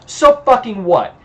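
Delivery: high-pitched retort, falling at the end; defiant retort. Acted. Vampire answers the hunter's accusation, defiant. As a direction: throw it back defiantly, ending on a fall.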